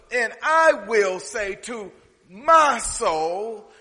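loud, slow, ringing pulpit delivery; full-force preaching. Acted. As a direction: preach it out big and drawn out. Preaching the rich fool parable.

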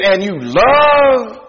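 slow, drawn-out doubting question; mock disbelief. Preacher mocking Israel's doubt of love, drawn-out disbelief. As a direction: draw out love, then pause.

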